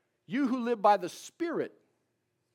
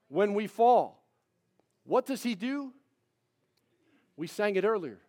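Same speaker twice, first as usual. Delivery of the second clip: usual speaking voice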